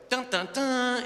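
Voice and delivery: high, loud mock suspense sting; dramatic dun dun dun. Shown as a demonstration. Showing the audience the cliffhanger sting. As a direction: belt a hammed-up cliffhanger sting. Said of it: "we could just go".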